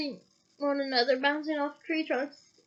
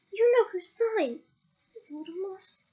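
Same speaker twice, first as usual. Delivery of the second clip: frightened moan; 5 semitones higher, slower than usual. Acted. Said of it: "Hermione moaned".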